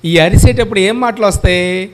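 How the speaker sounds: ordinary talk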